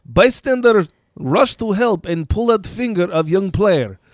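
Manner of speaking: put-on lightly done Russian accent. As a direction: read the report with a Russian accent. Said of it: "should I do this with a Russian accent?".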